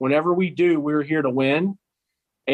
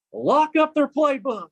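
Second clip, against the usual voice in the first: mock coach; 11 semitones higher, slower than usual. Mocking the coach's logic.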